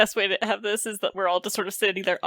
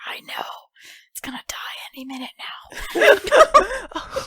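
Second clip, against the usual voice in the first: slow whisper breaking into laughter; laughing whisper; 11 semitones higher, much slower than usual. Acted. Friend whispering back a dark joke, laughing. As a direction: whisper it, barely holding in a laugh.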